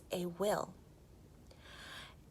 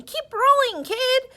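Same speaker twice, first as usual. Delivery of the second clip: high, loud encouraging yell; 9 dB louder than usual. A narrator's reading. Marvin pushing Rick downhill, high loud yell. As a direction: shout him on down the hill.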